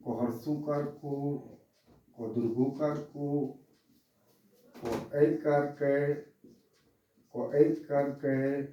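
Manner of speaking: slow, soft chanting of क कर का; barakhadi recitation. Acted. Teacher chanting the syllable table for pupils. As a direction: recite each syllable like a rote drill.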